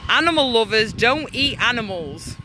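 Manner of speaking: normal talking voice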